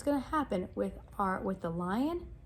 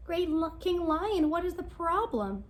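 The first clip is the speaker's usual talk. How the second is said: higher, concerned mouse; small mouse, higher and anxious; 6 semitones higher than usual. Acted. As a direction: call up worried to the lion. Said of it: "she asked".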